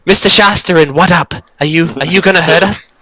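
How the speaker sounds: high, frantic goblin voice; a cowering goblin begging not to be killed; panicked goblins. Acted. Goblins voiced by game master.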